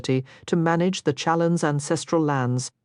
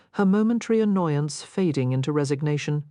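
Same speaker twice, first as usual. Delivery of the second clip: ordinary delivery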